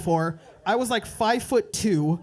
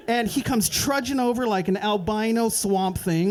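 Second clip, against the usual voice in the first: ordinary delivery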